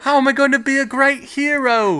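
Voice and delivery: high, whiny mock hero panic; mock panicking hero. Podcaster mocks the hero among countless cauldrons, high mock whine.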